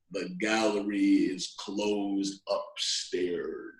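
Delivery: low, dragged-out Southern drawl; deep, slow security guard. Acted. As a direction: drip it out slow like molasses. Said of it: "He spoke in a slow low down voice".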